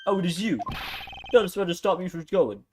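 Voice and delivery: put-on horrible Scottish accent. Suspect protests being held, in a horrible Scottish accent. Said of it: "doing, like, a horrible Scottish accent".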